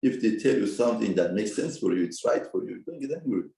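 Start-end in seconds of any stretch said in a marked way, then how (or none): none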